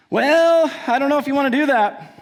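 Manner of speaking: high, pushy salesman voice; lightly voiced pushy salesman. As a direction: lean in like a car rental upseller. Salesman warning about the pass.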